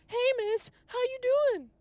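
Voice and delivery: squeaky, very high and loud greeting; high-pitched nervous greeting. Shown as a demonstration. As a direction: greet her in a tight squeak of nerves. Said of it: "over here high-pitched like".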